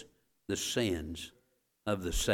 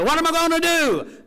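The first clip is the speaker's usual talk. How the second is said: very high, loud helpless wail; desperate cry; 10 dB louder than usual. Retold or imitated. Voter thrown out of meeting crying out in despair. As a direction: wail it like someone at wit's end.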